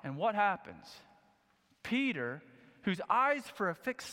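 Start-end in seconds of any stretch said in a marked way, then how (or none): none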